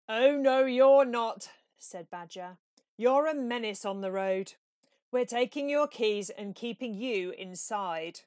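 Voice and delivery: loud firm scolding; stern Badger. A narrator's reading. Badger tells Toad loudly and firmly he stays inside. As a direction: lay down the law firmly.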